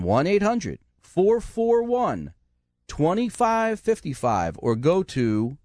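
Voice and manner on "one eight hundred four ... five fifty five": the number again, slower and higher; slow repeat. Shown as a demonstration. Radio host repeating the number for callers, slow and clear. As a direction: spell it out slowly for listeners who missed it. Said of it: "I'm gonna tell you again".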